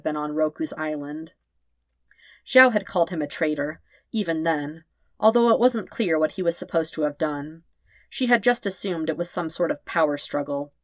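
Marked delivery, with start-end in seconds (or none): none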